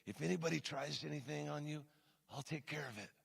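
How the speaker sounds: very soft, menacing mobster impression; Glenn. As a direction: offer protection like a Godfather, barely aloud. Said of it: "he talks like this".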